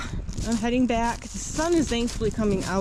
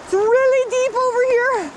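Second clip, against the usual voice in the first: alarmed cry; 13 semitones higher, 6 dB louder than usual. Real, not acted. Deep icy creek.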